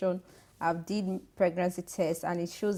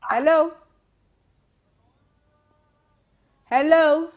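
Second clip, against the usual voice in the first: loud, high, questioning hello; calling out; 7 semitones higher, 11 dB louder, slower than usual. Real, not acted. Host checking whether the lost caller is there, calling out. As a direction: call down a dead line for an answer.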